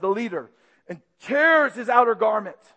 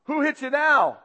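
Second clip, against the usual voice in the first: louder, sneering jeer; mocking jeer; 7 semitones higher, 5 dB louder than usual. Guards mocking blindfolded Jesus, loud jeer. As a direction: laugh at him with the question. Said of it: "They mocked him".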